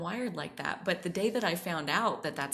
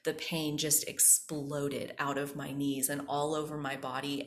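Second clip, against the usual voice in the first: ordinary talk, nothing marked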